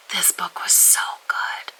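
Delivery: soft whisper. Real, not acted. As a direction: whisper the praise.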